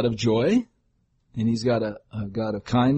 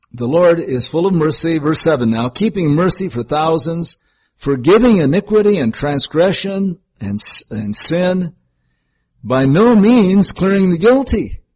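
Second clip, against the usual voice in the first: louder, raised reading voice building to the end; scripture reading; 4 semitones higher, 4 dB louder than usual. Acted. Preacher reading Exodus to the congregation, proclaiming. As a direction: proclaim the verse with rising force.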